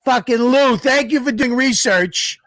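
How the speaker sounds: high-pitched, emphatic thanks; excited, high-pitched shout-out. Real, not acted. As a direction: exclaim it high and punchy. Emphatic thanks to Lou for research.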